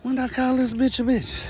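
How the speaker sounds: high-pitched baffled thought; puzzled inner voice. Retold or imitated. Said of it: "thinking to myself".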